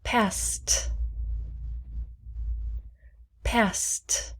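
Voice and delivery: whispered drill word. Shown as a demonstration. Teacher whispering a word for repetition. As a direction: breathe Passed softly. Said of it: "please repeat after me".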